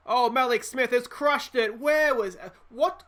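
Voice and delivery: thrilled commentator shout on a big hit; excited shout. Real, not acted. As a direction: burst out as the ball is crushed.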